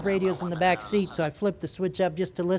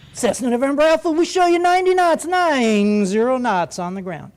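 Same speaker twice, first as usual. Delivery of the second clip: sing-song controller voice; 4 semitones higher, 5 dB louder than usual. Retold or imitated. Controller flattering a small-plane pilot like he's John Glenn, sing-song. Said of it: "they do that sing-song voice".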